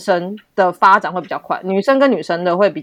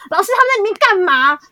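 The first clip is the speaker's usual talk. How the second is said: put-on much higher, nosy kid voice; curious students; 11 semitones higher than usual. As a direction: students tattling to the teacher. Students asking the teacher. (学生好奇地高声问老师)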